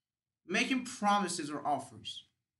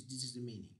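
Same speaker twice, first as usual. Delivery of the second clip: ordinary talk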